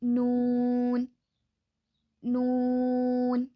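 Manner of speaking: नून slow, tongue edges to the side molars, nasal n; नून: long oo, ringing n; Arabic noon. Shown as a demonstration. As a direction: draw नून out slowly. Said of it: "जुबान के किनारे को side के दाढ़ों को लगाने".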